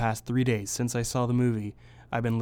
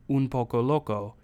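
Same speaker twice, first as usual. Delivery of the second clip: Spanish accent; 3 dB louder than usual. Shown as a demonstration. Showing the song's flair, Spanish-accented "Un Poco Loco". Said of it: "in the actual kind of accented way".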